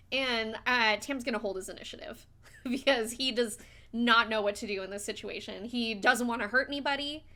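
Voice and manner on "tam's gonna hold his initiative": ordinary talk, nothing marked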